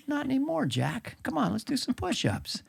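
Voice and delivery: a hammed-up old man boasting he's fit; Biden impression. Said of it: "let's go, Joe".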